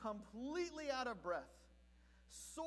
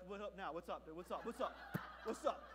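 street-gang nod; 5 semitones lower, 4 dB quieter than usual. Retold or imitated. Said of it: "begrudging street gang respect".

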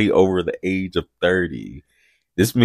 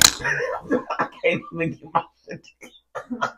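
muffled, speaking into a cup; 7 semitones higher, 8 dB quieter than usual. Real, not acted. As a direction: talk with a cup over your mouth. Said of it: "I'm over here talking into my cup".

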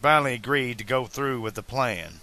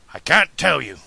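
louder, emphatic refusal; admitting; 3 semitones higher, 7 dB louder than usual. A narrator's reading. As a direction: admit it forcefully, holding back the answer. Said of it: "Doc admitted".

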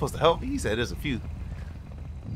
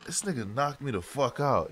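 ordinary talk, nothing marked

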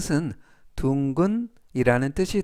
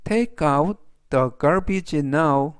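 "It has a round shape" read very slowly, word by word; deliberate classroom read; slow, clear reading; much slower than usual. Shown as a demonstration. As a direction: spell it out slowly for learners. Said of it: "그래서 전체적 의미는".

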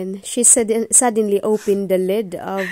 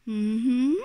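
ordinary delivery

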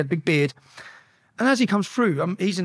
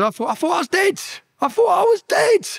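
high-pitched screamed panic, acted out; re-enacted screaming; 13 semitones higher, 3 dB louder than usual. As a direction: scream it out, reliving the adrenaline. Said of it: "And then I was screaming".

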